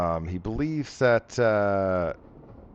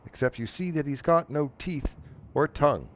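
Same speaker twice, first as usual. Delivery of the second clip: ordinary delivery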